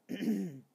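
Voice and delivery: awkward throat clear. Acted. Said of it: "Bear cleared his throat".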